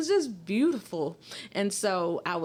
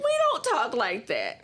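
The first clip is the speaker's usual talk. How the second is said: slow, higher, indignant correction; emphatic retort; 5 semitones higher, 3 dB louder, slower than usual. Retold or imitated. Mississippi woman retelling her comeback to a cab driver, slow indignant retort. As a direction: set the cabbie straight, drawing out each word. Repeats retort after table interruption.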